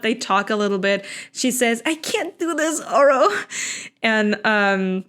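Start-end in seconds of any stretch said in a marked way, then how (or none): 1.9-3.3 higher, lightly voiced anguish; a touch of melodrama; mock despairing heroine. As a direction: heroine telling her friend she gives up. Retold heroine despairing to friend.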